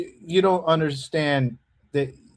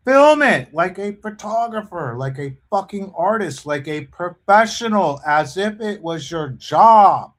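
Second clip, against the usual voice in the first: louder and louder, exasperated; building angry rant; 2 semitones higher, 7 dB louder than usual. Real, not acted. Ranting at auditors.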